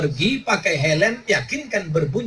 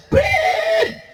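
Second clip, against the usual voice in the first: mock police whistle.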